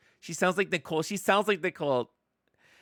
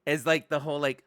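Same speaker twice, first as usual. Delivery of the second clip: normal talking voice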